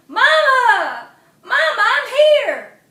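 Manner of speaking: high, loud, slow holler. Acted. Possum answering his mama, loud high holler. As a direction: holler back to your mama. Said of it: "Possum Mundus hollered".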